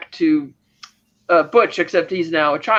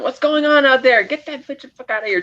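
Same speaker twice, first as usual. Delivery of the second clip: mock yell in a raised pitch; retold yelling; 7 semitones higher than usual. Imitating girlfriend who wants the woman out, mock yelling. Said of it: "she's still yelling".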